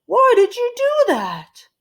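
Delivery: high, loud put-on shout; exasperated yell. Mister Rogers yelling at Amelia Bedelia, loud and dismayed. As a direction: yell her name in dismay. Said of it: "yelled Mister Rogers".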